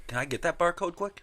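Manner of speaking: lightly voiced customer asking for the code; a hint of a cheeky customer; mock customer voice.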